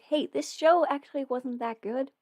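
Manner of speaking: ordinary delivery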